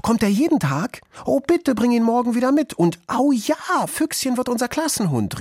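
very high-pitched clamouring schoolkids; excited children calling. A narrator's reading. First-graders begging to keep the class dog, excited high calls. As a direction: beg eagerly like a crowd of children. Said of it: "riefen die Kinder aus der eins C".

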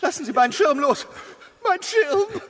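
high, loud, tearful cries; shouting and crying as the umbrella is taken; desperate shouting. Acted. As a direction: scream for the umbrella, near sobbing. Fighting over his umbrella.

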